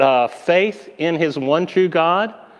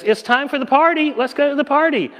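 high, bright calling out, lightly voiced; a bubbly servant summoning everyone to the party; cheery attendant; 10 semitones higher, faster than usual. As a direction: announce the party in a high, excited voice.

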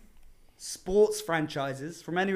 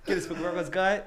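normal talking voice

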